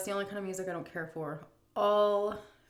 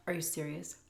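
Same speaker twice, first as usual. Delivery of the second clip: sighing whisper; scolding herself, whispered; whispered disbelief; 4 dB quieter than usual. Real, not acted. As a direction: breathe it out in disbelief.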